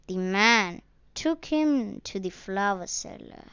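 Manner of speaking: usual speaking voice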